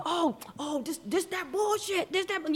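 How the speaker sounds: high, sneering mimic of a loudmouth; high mocking voice of a rude man; mocking rude moviegoer.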